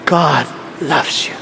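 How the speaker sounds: very low, slow, softened preacher voice; smooth preacher impression. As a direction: imitate a suave pastor, deep and unhurried. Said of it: "Pag ganyan, pag preach".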